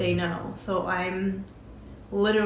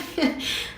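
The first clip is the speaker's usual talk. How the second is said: quick breathy giggle; a burst of disbelieving laughter; short laugh; 8 semitones higher than usual. Real, not acted. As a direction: laugh lightly before answering. Laughs at the CEO's reply.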